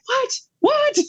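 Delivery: put-on high, puzzled child calling out; a little boy who missed the news; confused kid. Little brother calling from other room.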